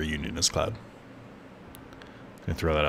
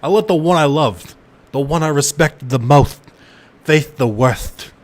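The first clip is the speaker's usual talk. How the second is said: Vincent voice; 6 semitones higher, 7 dB louder than usual. Acted. Streamer voicing Vincent's regret, louder anguished delivery. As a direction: lament it like a guilt-ridden gunman. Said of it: "questionable of an accent".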